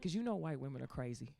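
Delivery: low, soft confiding; mother's warning. Acted. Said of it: "She said".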